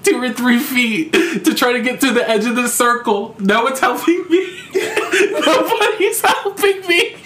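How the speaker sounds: high-pitched, laughing through the words; giggling through the story of crawling for help. Real, not acted. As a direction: laugh as you relive the scene. Laughing while telling the story.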